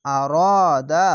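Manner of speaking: "আ র দা" with long "র" and "দা"; drawn-out vowels. Shown as a demonstration. Teacher showing alif lengthening, vowels drawn out. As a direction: stretch the vowels of "র" and "দা" one alif each. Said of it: "যাবার পাশে খালি আলিফ এক আলিফ টেনে পড়বেন".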